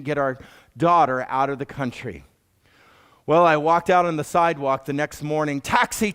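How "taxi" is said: high, eager call of a cab driver. Retold or imitated. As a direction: call for the fare, bright and upward. Cab driver calling out to customer.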